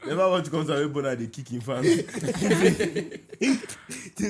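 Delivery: loud laughing rant. Real, not acted. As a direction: laugh through the words. Laughing rant about [name].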